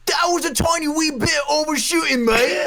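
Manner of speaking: put-on high, slow British ref accent with a laugh; hammed-up British referee accent, cracking up. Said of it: "I did not expect this accent".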